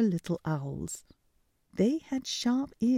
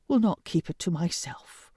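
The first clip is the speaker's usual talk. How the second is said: ordinary delivery, nothing marked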